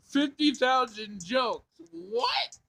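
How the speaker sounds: high, slow, astonished repeat; can't believe the number; stunned disbelief. Acted. Sam reacts to the robot's fifty thousand jokes, stunned.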